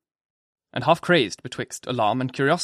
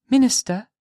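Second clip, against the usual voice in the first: put-on high child voice, not whispered; child's call; 11 semitones higher, 4 dB louder than usual. Pearl calling the minister in a high child's voice. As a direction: say it like a little girl. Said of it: "whispered little Pearl".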